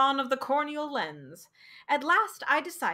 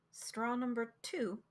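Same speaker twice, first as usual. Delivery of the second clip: much softer question; quiet ask; 10 dB quieter than usual. Acted. Young man asking the doctor on the bus, quietly. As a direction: ask it quietly, barely there. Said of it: "I asked quietly".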